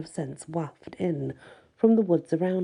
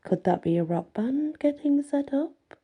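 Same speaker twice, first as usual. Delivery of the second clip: fake surprise; 9 semitones higher than usual. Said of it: "trying to look surprised".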